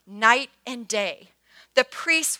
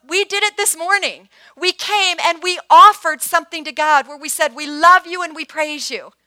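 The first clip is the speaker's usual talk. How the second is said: louder and higher, rousing the church; full-force preaching; 5 semitones higher, 10 dB louder than usual. Acted. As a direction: preach it out with fire.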